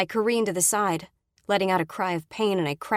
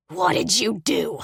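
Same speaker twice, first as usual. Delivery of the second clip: slower, louder, repeated demand; slow snarl; 5 dB louder, slower than usual. Acted. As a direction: lean on each word, menacing. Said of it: "Brady snarls at me".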